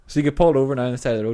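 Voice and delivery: normal talking voice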